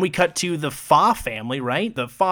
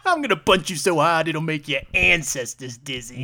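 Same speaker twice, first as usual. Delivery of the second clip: put-on Brooklyn accent. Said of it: "speak with, like, a Brooklyn accent".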